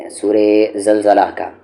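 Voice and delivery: ordinary talk, nothing marked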